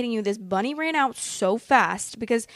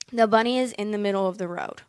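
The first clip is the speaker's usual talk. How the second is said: normal talking voice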